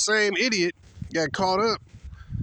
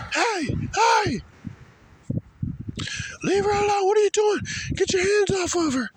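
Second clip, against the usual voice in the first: mock Chad boyfriend. Boyfriend telling police to let go, high squeaky mock yell. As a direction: squeak out a tough-guy challenge. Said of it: "Popeye the simp, Chad dude".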